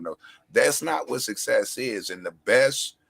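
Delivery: usual speaking voice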